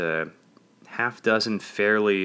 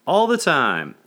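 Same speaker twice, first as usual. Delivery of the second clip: high, loud answering shout; shouted back; 8 semitones higher, 8 dB louder, slower than usual. A narrator's reading. Congregation answering their pastor, shouted back. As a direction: shout the response back heartily. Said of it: "the congregation shouted back".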